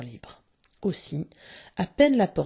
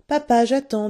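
put-on impatient, higher and louder child's line; coldly; 6 semitones higher, 7 dB louder than usual. A narrator's reading. Michael tells papa he is waiting, coldly. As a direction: say it coldly but forcefully, pitched up. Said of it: "dit Michael froidement".

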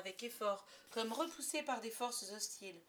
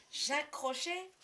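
aghast question; 5 semitones higher, 6 dB louder, slower than usual. Acted. Children horrified at pirate's name.

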